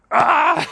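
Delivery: loud, high yell to propel yourself forward; mock charging scream; psyched-up yell. Younger self using anger to approach girls, psyched-up yell. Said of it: "use that anger to propel you forwards".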